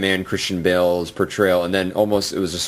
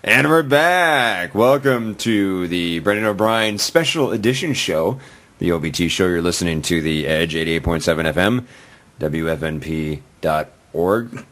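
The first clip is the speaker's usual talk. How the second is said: radio announcer. Acted. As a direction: read it like a DJ coming back from break. Radio station ID and return.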